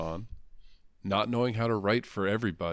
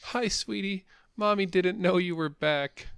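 much higher cooing mother voice; mock sweet mommy; 9 semitones higher than usual. Cohost mocks Lois cooing sweetly right after being choked. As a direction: coo like a doting mom. Mocking Lois's cooing line.

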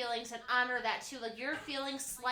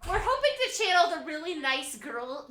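ordinary talk, nothing marked

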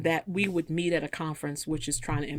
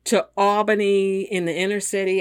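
high, loud, dawning recognition; suddenly remembering together, voice up high; an old friend realizing who you are, high and loud; excited realization; 4 semitones higher, 7 dB louder than usual. Retold or imitated. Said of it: "they were like".

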